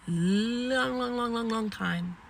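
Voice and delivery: "long" stretched high and chained without a break; "long, long, long" sung out for exaggeration, dropping on "time"; drawn-out repetition. Real, not acted. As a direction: hold the first "long", then run the rest together. Exaggerates how long she lived there.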